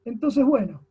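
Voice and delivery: ordinary talk, nothing marked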